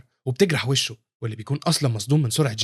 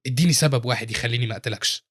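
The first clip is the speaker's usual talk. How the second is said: plain reading voice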